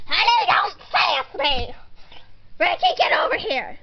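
loud, stern telling-off; scolding mom. Acted. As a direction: bawl out the kids for backtalk. Mother scolds and orders children.

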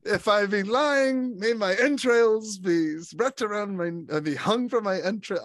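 high, loud, slow theatrical declaiming; over-the-top Hugh Grant villain. Retold or imitated. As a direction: declaim it like a hammy thespian. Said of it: "He's so over the top".

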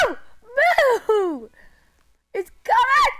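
high, loud frantic yelling; panicked yell. Real, not acted. Fleeing monster in game.

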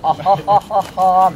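drawn-out loud laugh; slow acted laugh. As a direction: laugh slowly and loudly. (わざとらしい笑い)

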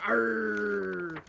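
growly pirate arr. Pretend. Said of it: "we should go".

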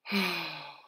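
loud, voiced sigh; motherly sigh. A narrator's reading. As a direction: sigh heavily over a looming problem. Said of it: "Missus Huggins sighed".

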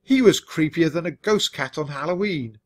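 ordinary delivery, nothing marked

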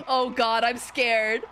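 usual speaking voice

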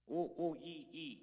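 loud hooting oh oh ee ee; playful monkey call; monkey noises. Shown as a demonstration. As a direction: hoot like a monkey. Demos monkey noises for kids.

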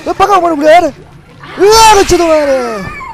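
high, loud angry yelling; furious shout. Real, not acted. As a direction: scream at the monster to come. Fighting monster furiously.

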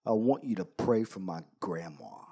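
big bad biker, gruff and low. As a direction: imitate a gruff biker, pitched well down. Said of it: "in his gruff voice".

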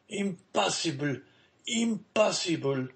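high cry of amazement; disbelieving exclamation. A narrator's reading. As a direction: exclaim it twice, unable to believe. Morel cannot believe the miracle.

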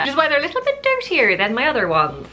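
put-on Irish accent. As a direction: slip into a lilting Irish accent. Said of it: "doing an Irish accent".

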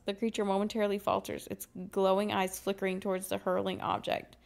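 ordinary talk, nothing marked

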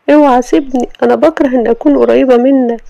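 wife rebuking the husband for banging on her door; indignant Sama. Acted. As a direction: snap back at the rude husband.

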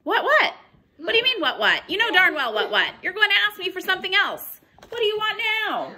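put-on fed-up child complaining at bedtime; annoyed. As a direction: snap back at the pestering monster. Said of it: "You sound mad".

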